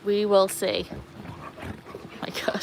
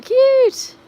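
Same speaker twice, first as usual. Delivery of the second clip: squealed delight; 7 dB louder than usual. Real, not acted. Reacting to a floating heart gift.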